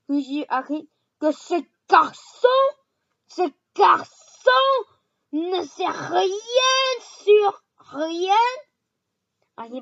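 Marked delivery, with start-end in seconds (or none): 1.2-8.3 loud, high, slow outraged yell; furious shout. A narrator's reading. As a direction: drag each word out in rage. Hagrid furious, slowed.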